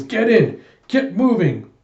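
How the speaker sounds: high, loud command; urgent shout. Retold or imitated. Alita ordering them into her jeep, urgent shout.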